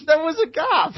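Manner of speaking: high, laughing self-defence; laughing protest. Real, not acted. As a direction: defend yourself while cracking up. Co-host laughs defending himself.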